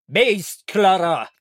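high, sharp command; shouted. A narrator's reading. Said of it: "آقای مردستون فریاد زد". (با فریاد و آمرانه)

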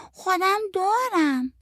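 put-on high, drawn-out little-kid voice; reply slowly like a little child; small child. A narrator's reading. Child character replies to adult. (صدای نازک و کشیدهٔ بچه)

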